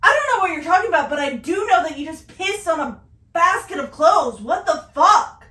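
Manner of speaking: loud, high, exasperated tirade; mad. Retold or imitated. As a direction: chew him out at full volume. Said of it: "I was so mad".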